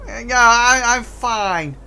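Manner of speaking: high, loud flustered goblin. Acted. Hurt goblin assuring the players he's fine. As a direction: brush it off too fast.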